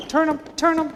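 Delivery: loud, high shout; defensive call. Real, not acted. Coach calling to defenders to turn the dribbler, loud.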